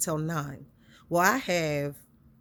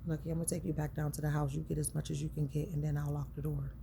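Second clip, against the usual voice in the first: hushed; 2 semitones lower, 6 dB quieter, faster than usual. Retold or imitated. Constable's quiet words retold.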